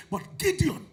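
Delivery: rising preacher's call to Gideon; preaching at full force. Acted. Preacher calling out to his congregation, preaching hard. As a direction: build it like a pulpit appeal.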